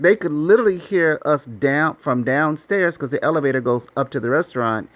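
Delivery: ordinary talk, nothing marked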